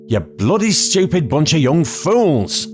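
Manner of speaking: high, slow, furious outburst; outraged yell. A narrator's reading. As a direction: bellow it at the fools in disbelief. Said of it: "Iris could hardly believe her ears".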